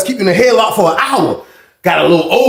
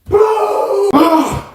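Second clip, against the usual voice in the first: slam boom. Retold or imitated. Impact of the slam.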